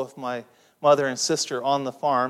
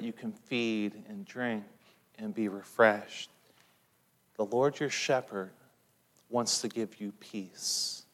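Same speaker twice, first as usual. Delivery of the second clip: tender pastoral calm; 5 semitones lower, 8 dB quieter than usual. Acted. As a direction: soothe fearful listeners toward peace. Preacher softly comforts the congregation.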